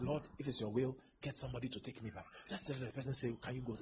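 soft, low, shy asking; timid quiet plea. Retold or imitated. Preacher imitating a stranded believer's meek plea. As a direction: ask meekly, almost under the breath.

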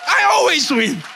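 loud, high, boastful catchphrase shout. Acted. Preacher mimics a celebrity's boast, loud and triumphant. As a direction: hype it up as a hammed-up brag. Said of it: "It's only [name] who talks like that".

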